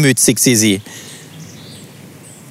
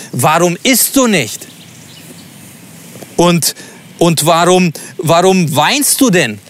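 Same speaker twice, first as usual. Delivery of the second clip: higher, faintly accusing question; reproachful; 4 semitones higher than usual. Shown as a demonstration. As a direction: let a hint of blame creep in. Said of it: "Oder vielleicht gar vorwurfsvoll".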